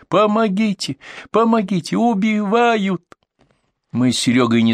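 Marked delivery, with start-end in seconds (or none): 0.1-3.0 put-on high, panicked old woman screaming for help; granny's cry for help. A narrator's reading. Old woman upstairs yelling for help, panicked high cry. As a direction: shriek it like a terrified granny. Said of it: "сверху раздался крик бабули".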